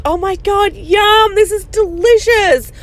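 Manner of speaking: high sugary fake delight; pretending to love the gross coffee; mock delighted mom. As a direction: gush like it's a treat, way up high. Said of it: "I'd be like".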